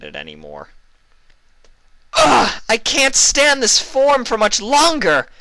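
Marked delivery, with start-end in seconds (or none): 2.1-5.3 high, loud and fed up, slowly dragged; frustrated exclaim. A narrator's reading. Luna bursting out at her calm sister, loud and exasperated. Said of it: "she exclaimed suddenly".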